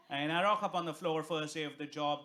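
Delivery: ordinary talk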